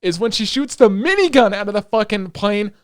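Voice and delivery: loud, heated rant about the scene; loud angry rant. Real, not acted. Podcaster ranting to cohost about the helicopter scene, loud and heated. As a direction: vent hard and fast, fired up. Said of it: "I need to bitch".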